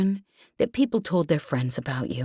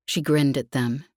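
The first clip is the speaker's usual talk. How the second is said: ordinary delivery, nothing marked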